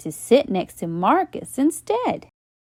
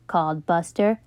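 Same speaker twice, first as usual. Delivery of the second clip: usual speaking voice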